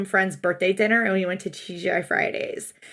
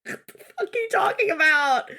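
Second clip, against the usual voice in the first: laughing disbelief; 8 semitones higher than usual. Real, not acted. Incredulous laughing retort.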